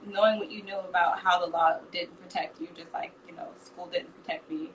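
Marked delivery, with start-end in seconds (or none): 1.9-4.7 dropping almost to a whisper, soft; half-whispered, hurting; hushed and breaking. Real, not acted. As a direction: let the voice fall away on a painful memory. Painful memory shared quietly.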